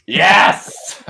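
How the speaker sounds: loud, high burst of delight; host cheering the Dungeons and Dragons answer, loud and thrilled; excited cheer. Real, not acted. Host reacting to the guest's answer, a loud excited cheer.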